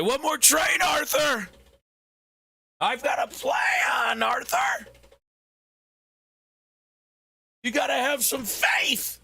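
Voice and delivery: high shouted Dutch van der Linde voice, cracking; strained high shout, voice cracking; Dutch impression. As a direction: yell it like a desperate gang leader. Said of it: "start sounding like Dutch".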